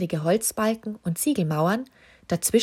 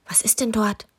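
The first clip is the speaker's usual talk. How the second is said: whisper; 3 semitones higher than usual. A narrator's reading. Susi whispers to the king at the ladder foot. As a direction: whisper so nothing wakes up. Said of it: "fragte mit leiser Stimme".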